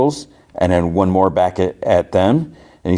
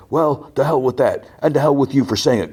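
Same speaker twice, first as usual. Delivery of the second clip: higher-pitched acted outburst; retold indignant comeback, pitched up; angry retort; 8 semitones higher than usual. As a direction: throw it back as a mock-angry heckler. [name] cuts in, cursing Carlisle.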